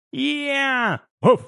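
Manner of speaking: high donkey-like cry, then a short bark; high bray and short woof; pet animal noises. A narrator's reading. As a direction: imitate two small animals agreeing. Pets agree with animal noises.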